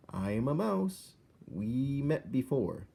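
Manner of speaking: high, slow little mouse; patient tiny mouse explaining. A narrator's reading. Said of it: "said Despero".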